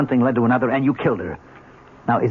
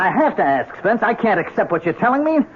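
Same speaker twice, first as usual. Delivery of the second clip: higher and insistent, pushing him to think; urgent pressing; 11 semitones higher than usual. Acted. Inspector pressing his friend to think, urgent and raised.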